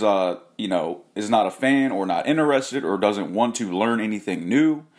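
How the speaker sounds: ordinary talk, nothing marked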